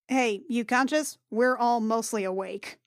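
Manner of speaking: usual speaking voice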